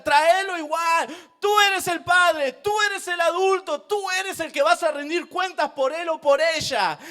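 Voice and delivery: loud, high, insistent preaching; preaching at full force. Real, not acted. Preacher warns fathers they answer for children, forceful. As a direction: drive it home to the fathers.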